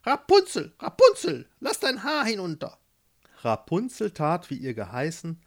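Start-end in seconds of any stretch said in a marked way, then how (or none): 0.0-2.8 high, loud call up a tower; witch's loud call. Acted. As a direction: call sharply upward as the witch. (laut und hoch hinaufrufende Hexe)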